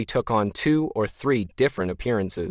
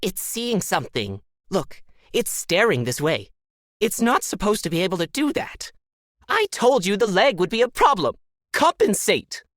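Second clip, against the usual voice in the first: alien voice; 10 semitones higher than usual. A narrator's reading. Disembodied voices panicking.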